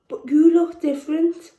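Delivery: put-on a kid blurting his doubt; boy's voice. Boy replies in the poem.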